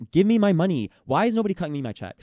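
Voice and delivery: really venting; exasperated demand. Real, not acted. Complaining to a friend about unpaid pay, a real fed-up outburst.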